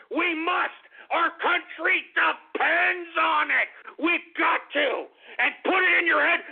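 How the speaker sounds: fired-up and insistent; angry rant. Real, not acted. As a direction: hammer each demand home. Furious political rant to listeners.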